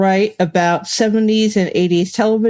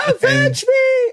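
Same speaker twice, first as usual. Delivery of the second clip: overacted death scene; slower than usual. Mock dying hero's cry.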